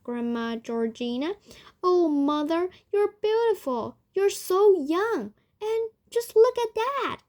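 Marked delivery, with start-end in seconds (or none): 1.8-7.2 high, raised, gushing wonder; thrilled daughter exclaiming; amazed shouting. A narrator's reading. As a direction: gush over a miraculous change. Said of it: "shouted Missus Bucket".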